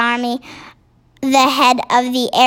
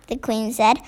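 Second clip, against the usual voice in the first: usual speaking voice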